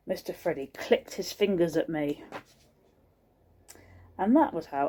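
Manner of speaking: plain reading voice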